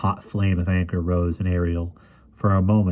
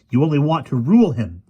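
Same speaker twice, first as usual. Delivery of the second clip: high, loud indignant outburst; angry accusation; 7 semitones higher, 7 dB louder than usual. A narrator's reading. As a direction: throw the accusation in the rival's face. Ariel's angry retort continues.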